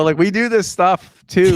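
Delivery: incredulous scolding. Retold or imitated. Lecturing wife, incredulous.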